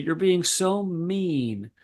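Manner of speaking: put-on high, slow, whiny little girl voice; mock-tearful child begging to stay; upset four-year-old. Child's accusation, voiced by teller.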